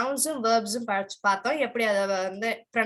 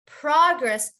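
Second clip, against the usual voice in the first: PROG-ress, first syllable higher and louder; stress on first syllable; 4 semitones higher, 5 dB louder than usual. Shown as a demonstration. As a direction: punch the start of progress. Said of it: "emphasize the beginning".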